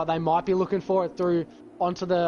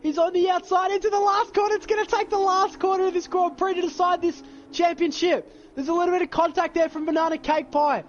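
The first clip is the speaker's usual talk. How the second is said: motorsport commentator; 11 semitones higher than usual. Real, not acted. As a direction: shout the final corner live. Last corner decides championship.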